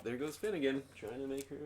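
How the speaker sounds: ordinary talk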